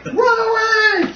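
very high, drawn-out call; hammed-up fleeing cry; mock panicked cry. Game master calls out as party flees the shaking, mock panic.

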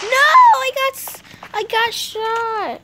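loud high cry of dismay; dismayed shout. Real, not acted. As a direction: yell as you get hit. Gets shot in the game.

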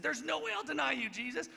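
mock indignant shout; mock Peter shouting. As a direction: overplay a proud loyalist. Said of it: "he might have said".

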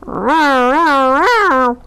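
big, loud playful lion roar. Acted. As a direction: roar it out loud and high for the kids. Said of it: "roar as loud as you can".

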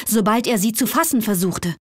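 plain reading voice

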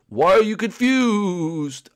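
high, slow, drawn-out question; slow ghostly wail of a question; puzzled ghost friend. Acted. Ghost Blimpy answers Luigi.

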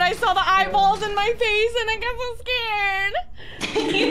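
giggly spooked retelling; laughing scared. Real, not acted. Startled by eyeballs in game.

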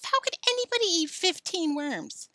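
high-pitched disbelieving question; incredulous outburst. Acted. Alan doubting anyone could eat fifteen worms, incredulous. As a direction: blurt it out in disbelief. Alan frets about the dare.

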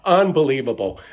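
ordinary talk, nothing marked

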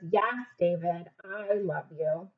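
soft, slow, tender; loving mom. Acted. Mom hugging David after trouble. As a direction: reassure him gently. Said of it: "And Mom says".

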